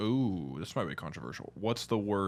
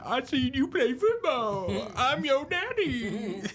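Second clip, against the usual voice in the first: much higher, slow, laughing goofy voice; Waterboy impression; 5 dB louder, slower than usual. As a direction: drawl it out like the dopey coach. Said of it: "He's like".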